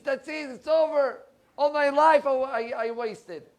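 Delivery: high, loud, slowing to a drawn-out end; despairing cry. Retold or imitated. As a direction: cry out that a whole life was wasted. Imagined despairing inner voice.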